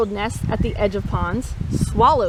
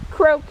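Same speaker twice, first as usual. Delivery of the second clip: croak; 4 semitones higher, 3 dB louder than usual. Retold or imitated. Frog sound after story of frogs.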